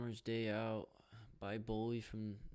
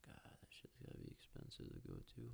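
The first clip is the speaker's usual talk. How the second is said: hushed aside to himself; whisper; 3 semitones higher, 12 dB quieter than usual. Real, not acted. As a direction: breathe it out with no voice. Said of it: "unvoiced whisper".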